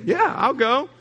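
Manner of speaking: bright rising yeah, eager to come; eager yes. Pretend. Invitee happily accepting the friend's church invite, eager. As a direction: say yes like you can't wait.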